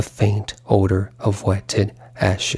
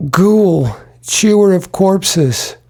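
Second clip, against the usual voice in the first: anguished outcry; 8 semitones higher, slower than usual. Acted. Stephen crying out at his dead mother's ghost, anguished. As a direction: fling the words at the ghost.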